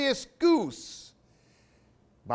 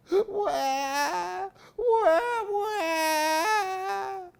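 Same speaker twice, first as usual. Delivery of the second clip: high, hammed-up crying wah wah; a big tree wailing like a toddler; much slower than usual. The tree wails at Albert, high mock crying. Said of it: "wailed the tree".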